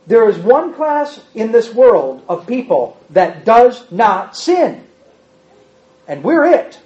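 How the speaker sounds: ordinary delivery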